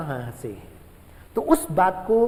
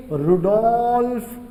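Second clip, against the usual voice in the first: drawn-out Rudolf, then a punchy विर्को; slow clear naming; slower than usual. Shown as a demonstration. Teacher to students naming the scientist, slow and clear. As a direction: announce the name slowly so it sticks.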